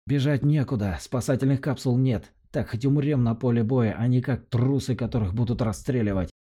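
high ringing voice of a doomed commander. A narrator's reading. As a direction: ring out high and tense. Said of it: "звенящим голосом".

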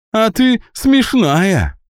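high, slow, still amused; after laughing hard. Acted. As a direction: drawl it out after a big laugh. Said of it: "и заливисто расхохоталась". (после сильного смеха)

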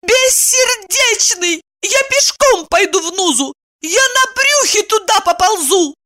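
high-pitched melodramatic protest; outraged cry. A narrator's reading. Woman insisting she will go too, outraged cry. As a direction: vow it shrieking with indignation. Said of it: "крикнула она".

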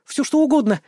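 put-on high, eager girl's reply; instant agreement. A narrator's reading. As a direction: jump at it in a high voice. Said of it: "моментально согласилась она".

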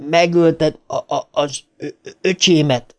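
halting stutter on repeated syllables; stammered accusation. A narrator's reading. Bill confronts his brother's killer, stammering.

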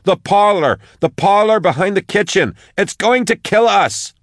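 high, urgent plea for help; desperate yell. A narrator's reading. Max begs for help over the vox, desperate yelling. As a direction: cry for rescue in rising panic. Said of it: "she yelled".

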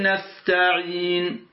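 نستعين with the ya held two counts; نستعين lengthened briefly on the ya; نستعين with a short two-beat ya; two-count length. Shown as a demonstration. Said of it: "هذه حركتان".

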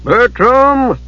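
high, commanding call. A narrator's reading. Unseen master summoning the monk, calling out. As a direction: call the name out to summon him. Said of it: "a voice called from within".

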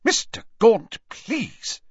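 high, shocked protest; appalled plea, jumping up in pitch. A narrator's reading. Official protesting to an abusive father, shocked. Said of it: "said Ogden in a shocked voice".

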